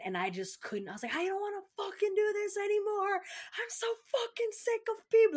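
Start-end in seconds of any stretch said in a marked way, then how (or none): 1.2-5.4 high, loud, strained venting; fed up and cracking; frustrated outburst. Retold or imitated. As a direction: let it all burst out. Venting breakdown in the car.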